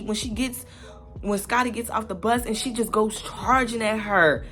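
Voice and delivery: ordinary delivery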